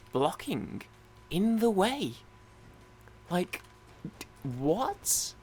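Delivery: halting disbelief climbing to a high pitch; incredulous. Retold or imitated. Gamer recalling people blocking the queue, incredulous. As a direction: space the words out in baffled outrage.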